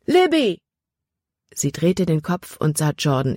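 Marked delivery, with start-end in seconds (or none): 0.1-0.6 high call across the stream; loud call over rushing water; shouting her name. A narrator's reading. Jordan calls from the stream.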